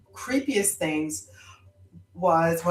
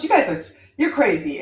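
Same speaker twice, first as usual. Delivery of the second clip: lightly voiced Jamaican aunt; a hint of a Jamaican accent; 3 semitones higher, 4 dB louder than usual. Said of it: "in her Jamaican accent".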